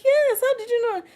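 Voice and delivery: very high, delighted surprise; mock amazement. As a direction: squeal it like they guessed your secret. Acts delighted reply to guess.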